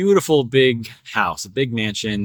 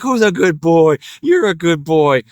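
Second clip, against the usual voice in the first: louder, gushing owner-to-pet voice; farmer praising dog; 2 semitones higher, 4 dB louder than usual. Retold or imitated. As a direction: imitate an owner fussing over the dog. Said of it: "He's like".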